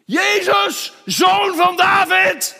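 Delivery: schreeuw, veel hoger en harder; luid roepend. Acted. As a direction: roep het uit boven de menigte. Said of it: "Ik ga het nog harder roepen". (Bartimeüs schreeuwt boven de menigte uit)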